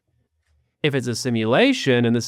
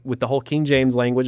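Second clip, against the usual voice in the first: ordinary talk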